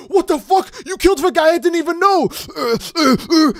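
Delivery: high, whiny mock outrage; mock Gohan outburst. Streamer mocking Gohan's grief, whiny high outrage. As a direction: ham up Gohan's baffled anger.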